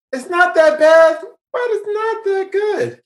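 put-on very high, squeaky verdict; high-pitched hedging. Host explaining a middling movie rating, in high put-on voice. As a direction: lift it way up, wavering on the rating.

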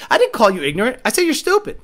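ordinary talk

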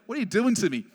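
exasperated protest. Retold or imitated. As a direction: protest in comic dismay. Mock protest at what happened.

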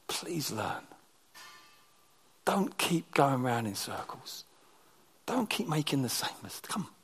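low, soft voice of a patient father; hushed, loving correction; tender pleading. Retold or imitated. Voicing God gently correcting his child.

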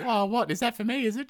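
hammed-up flirty sister; coy flirty woman. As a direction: play the coquette, high and teasing. Shurpanakha flirting voiced.

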